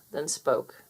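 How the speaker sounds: ordinary delivery, nothing marked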